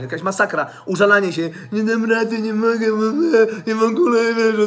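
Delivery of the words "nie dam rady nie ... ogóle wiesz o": mock whiner. Mimicking whining viewer.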